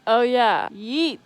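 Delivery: loud joyful yell; triumphant shout. Real, not acted. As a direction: shout it in victory.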